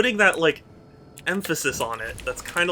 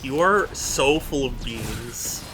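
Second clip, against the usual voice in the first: exasperated. Real, not acted. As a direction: stretch the words out, fed up. Fed up with Groovy's teasing.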